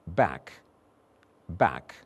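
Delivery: "Back" twice, low flat a vowel; vowel demo. Shown as a demonstration. Teacher modelling the vowel in back. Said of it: "And finally I said".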